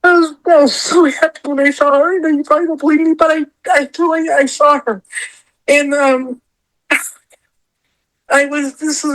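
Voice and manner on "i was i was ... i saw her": crying through the words, higher pitch; tearful. Real, not acted. Telling of seeing her idol, tearfully insisting. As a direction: push on through tears. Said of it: "talk about this without crying".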